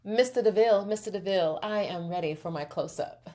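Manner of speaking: lightly hammed-up film diva; a hint of old-movie melodrama, brisk start easing off; movie-star diva. Star telling the director she's ready, hammed-up movie-star diva. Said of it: "ready to go full movie mode".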